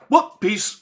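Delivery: high, loud, quick bail-out; fleeing imps. Acted. Game master voicing imps fleeing the dragon, high flippant yelp. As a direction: toss off a high, cheery quick exit. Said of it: "They're just like".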